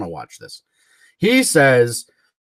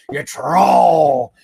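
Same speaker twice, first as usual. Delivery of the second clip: rising to a loud, slow, higher shout; Sam's emphatic yell; 9 semitones higher, 6 dB louder, much slower than usual. Retold or imitated. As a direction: hammer every word like Sam does. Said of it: "Sam really puts a lot of emphasis on it".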